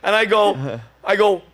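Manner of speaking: ordinary talk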